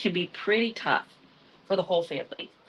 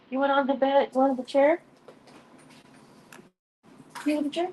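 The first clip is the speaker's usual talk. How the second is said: higher, sing-song pet voice; 6 semitones higher, faster than usual. Real, not acted. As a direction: coo at the cat. Coaxing her cat onto chair.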